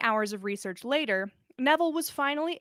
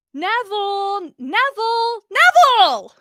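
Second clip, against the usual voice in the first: calling out; 10 semitones higher, 13 dB louder, slower than usual. Acted. Ginny calls him from his concentration.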